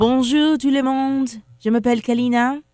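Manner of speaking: Bonjour tout le monde in a French accent; showing off her French, as asked; French on request. Shown as a demonstration. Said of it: "Say something in French".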